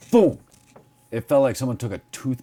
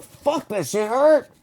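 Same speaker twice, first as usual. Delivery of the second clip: pained complaint; 11 semitones higher, 3 dB louder, slower than usual. Retold or imitated. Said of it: "I'm like".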